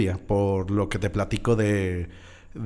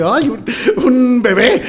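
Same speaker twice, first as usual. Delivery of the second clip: much higher, slow, half laughing; shocked surprise; 13 semitones higher, 7 dB louder, slower than usual. Retold or imitated. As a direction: gasp out the words in disbelief.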